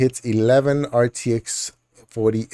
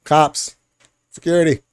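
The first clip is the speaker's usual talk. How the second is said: higher, louder mock cry for cops; hammed-up alarm, much higher; mock call for help; 7 semitones higher, 4 dB louder than usual. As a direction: play-act calling security. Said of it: "I don't like how you're looking at me".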